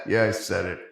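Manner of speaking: said through a laugh; chuckling while owning the joke. Real, not acted. Laughing at his own joke.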